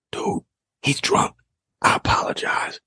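put-on a teammate whispering sorry to the kid; whispered apology.

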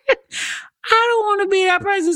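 high, laughing repeat. Real, not acted.

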